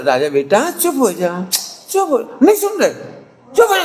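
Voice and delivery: put-on a mother coaxing her crying baby, rising into a high pleading; mother coaxing sweetly. Preacher voicing a mother hushing her baby, mock coaxing. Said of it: "mummy उसे बड़े प्यार से".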